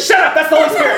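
high, loud shushing yell; comic shouted command. Retold or imitated. Preacher silencing shaking professor, loud shout. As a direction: shout her down, still praying. Shouts back during prayer.

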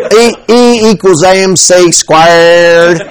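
slow, drawn-out Southern drawl; exaggerated dumb-sounding Southern accent. Shown as a demonstration. Preacher showing Einstein sounds dumber, slow Southern accent. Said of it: "if it's a Southern accent, you sound dumber".

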